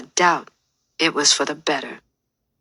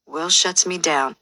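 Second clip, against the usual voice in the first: ordinary delivery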